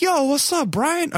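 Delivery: very high-pitched bro hello, acted out; eager, high retold greeting; excited greeting. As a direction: replay hailing a pal you didn't expect.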